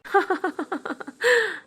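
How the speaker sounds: high, soft burst of laughter; mocking laugh. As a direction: laugh at her again. [name] laughs again.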